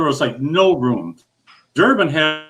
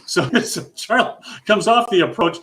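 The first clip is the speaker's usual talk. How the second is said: usual speaking voice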